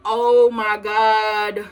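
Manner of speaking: staring at the wrecked car, slow stunned exclamation; stunned gasp. Retold or imitated. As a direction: stretch it out in disbelief. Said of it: "I looked at the car and I was like".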